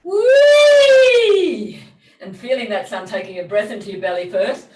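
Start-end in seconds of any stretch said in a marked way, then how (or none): 0.0-1.8 high loud siren whoop; joyful loud wee; playful whee. Shown as a demonstration. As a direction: swoop up like a siren, full voice. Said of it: "Let it be a playful sound".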